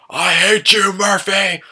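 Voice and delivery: high, loud heckler's yell; imitated yelling. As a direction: holler it like a rowdy fan in the crowd. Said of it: "he was just yelling random shit".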